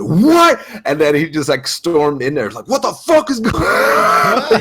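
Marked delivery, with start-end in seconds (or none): 0.0-0.6 high, loud shocked "what"; retold indignant yell; outraged disbelief. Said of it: "he just went". 2.7-4.6 high, loud, drawn-out outrage; imitating an irate friend's shout; retold angry yell. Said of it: "He started yelling at the people".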